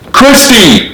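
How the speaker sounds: high shriek of her name. A narrator's reading. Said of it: "shrieked Richard".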